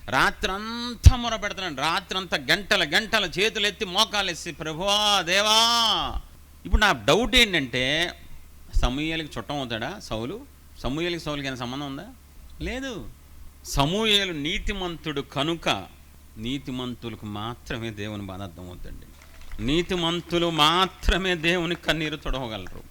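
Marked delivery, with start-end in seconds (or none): 4.5-6.2 a long, drawn-out, louder cry of ప్రభువా, దేవా; anguished cry to God. Acted. Samuel calling out to God through the night, drawn-out anguished cry. 16.4-19.0 a hushed first telling; quiet, lowered. Shown as a demonstration. Preacher to congregation, quietly lowered voice. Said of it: "repeats the line louder".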